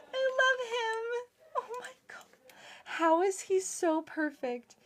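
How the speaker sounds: high, teary, broken gushing; happy tears. Real, not acted. Vlogger crying happy tears over her new pet robot. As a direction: let joy tip into tears. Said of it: "I'm gonna cry".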